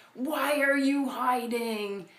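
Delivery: slow, loud, booming demand; thundering God. Retold or imitated. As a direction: boom it down like an angry god. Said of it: "thunders again".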